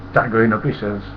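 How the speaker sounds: ordinary talk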